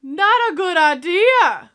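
loud, slow, drawn-out warning call; shouting at the movie character; yelled warning. Real, not acted. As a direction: call out to her like she can hear you. Yelling warning at the screen.

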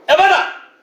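high, loud shouted command. Shown as a demonstration. Preacher shows the congregation what to shout, commanding shout. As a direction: shout it out with full authority. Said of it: "세 번 외쳐".

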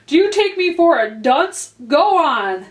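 high, loud, sharp rebuke. A narrator's reading. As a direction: snap at him like an impatient king. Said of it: "said the king sharply".

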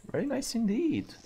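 high put-on gloating voice; mock posh voice. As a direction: say it like a smug butler.

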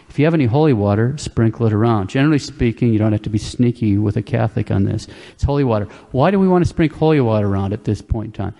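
ordinary delivery

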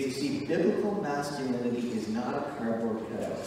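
ordinary talk, nothing marked

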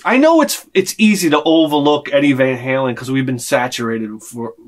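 ordinary talk, nothing marked

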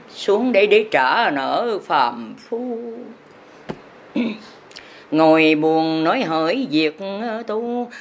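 ordinary talk, nothing marked

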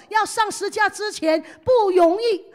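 normal talking voice

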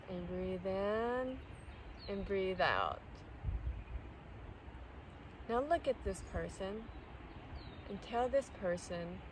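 soft, unhurried meditation voice with long pauses; guided meditation. Real, not acted. Guiding listeners to release someone's energy, soft calm meditation voice. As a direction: guide them gently through each breath.

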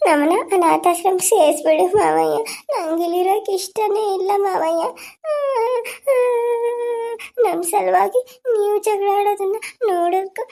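high, slow, tearful pleading to her uncle; a little girl sobbing as she begs; crying child. Acted.

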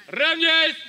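barked military command; loud parade-ground call; shouting drill commands. Real, not acted. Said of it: "давайте всё-таки поактивней".

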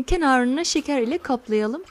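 usual speaking voice

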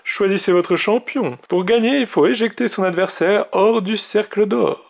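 high, loud tournament announcer; ringing, high-pitched mage announcement; mage announcing the trial. Acted. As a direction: proclaim the rules to the contestants.

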